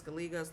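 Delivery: "Gallegos" as guh-LEE-gos; English-style "Gallegos" with an L and long E; another American mispronunciation. Shown as a demonstration. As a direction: say "Gallegos" anglicised, middle syllable LEE. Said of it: "lots of different ways".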